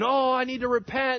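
high, loud mock wail of repentance; hammed-up desperate cry to God. Weeping congregation yelling out to God, mock anguished cry. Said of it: "crying and yelling out to God".

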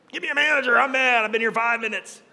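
a hint of a demanding customer, pitch pushed up; half-hearted angry demand for a manager; mock angry customer. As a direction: lightly play his old impatient self. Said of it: "that's how I was, like that angry person".

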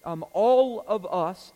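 all of us, with all hit hard and slow; stress on all. Shown as a demonstration. Preacher stressing all to the congregation, all punched loud. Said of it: "emphasis on that word all".

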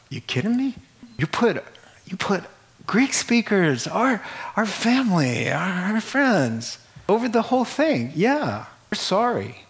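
a lightly voiced aggrieved crowd; mock complaint. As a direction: voice the slighted families protesting. Widows' families complaining, voiced.

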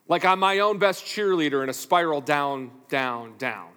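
ordinary talk, nothing marked

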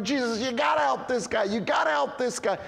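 friends shouting for their blind buddy; urgent shout. Retold or imitated. Friends pleading urgently to Jesus.

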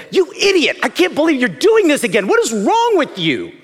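high-pitched scolding tirade; loud, contemptuous scolding; harsh condemning voice. Acted. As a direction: berate them in exasperation. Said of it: "that voice sounds a lot different than".

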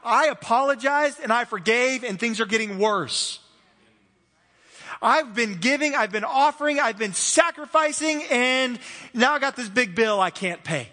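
worked-up, pleading protest; acted panic. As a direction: act out someone panicking, pitch climbing as the list grows. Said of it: "You're gonna panic because you're like".

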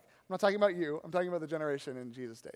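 usual speaking voice

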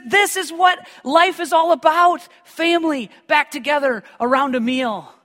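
a father with his family back at the table; rejoicing father. Retold or imitated. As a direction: burst out with a father's joy. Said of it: "he rejoices".

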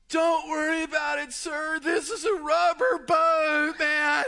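high, loud, breezy dude voice; carefree guide. Retold or imitated. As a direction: shrug off the danger. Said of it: "this is what the guy told".

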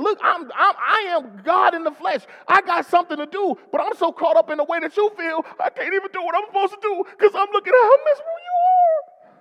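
high, loud, rushing voice of an overwhelmed Jesus; Jesus pleading. Retold or imitated. Preacher voicing Jesus caught up in her sorrow, high and loud. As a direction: play Jesus too moved to get on with his work. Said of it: "He's like".

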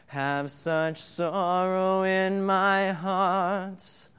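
drawn-out, solemn psalm reading over music; slow solemn scripture reading. Acted. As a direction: read each line slowly like a prayer.

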